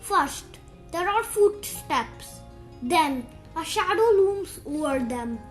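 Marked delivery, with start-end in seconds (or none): none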